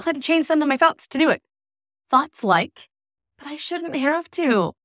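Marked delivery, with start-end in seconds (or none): 3.4-4.7 slow, whiny complaining. Shown as a demonstration. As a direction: whine it like a sulky brain. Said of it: "I'm saying this in a whiny voice".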